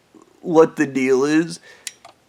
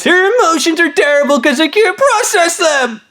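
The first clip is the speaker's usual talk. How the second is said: high-pitched exasperated squawk; mock their meltdown in a high, squeezed voice; mocking outburst; 12 semitones higher than usual. Ranting about guys dumping their emotions, mocking shrilly.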